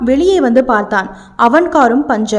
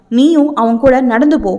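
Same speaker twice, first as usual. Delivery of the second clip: ordinary delivery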